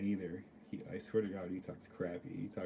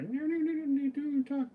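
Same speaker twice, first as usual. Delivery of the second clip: put-on high squeaky girly voice scolding him; mock girls' scolding. Girls shushing him, high mock voice.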